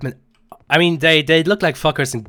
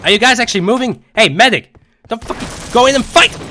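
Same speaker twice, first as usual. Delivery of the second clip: shouting; 9 semitones higher, 7 dB louder than usual. Real, not acted. Shouting at squad mid-fight.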